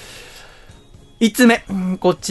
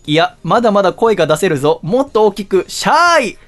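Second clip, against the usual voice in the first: a light drill sergeant, rising to a high yell; barking for more volume, playfully; 4 semitones higher, 4 dB louder than usual. Acted. Host pushes for a louder reply.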